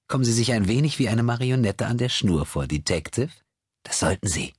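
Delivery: hoher, spöttischer Schurke; höhnische verzerrte Stimme. A narrator's reading. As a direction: verspotte ihn wie eine Marionette. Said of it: "künstlich verzerrte Stimme". (höhnische verzerrte Stimme)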